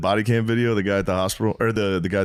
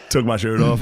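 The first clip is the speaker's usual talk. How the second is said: normal talking voice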